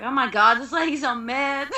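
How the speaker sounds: high, loud mock disbelief; shocked reaction. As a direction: exclaim it like scandalized gossip. Retelling her shocked reaction.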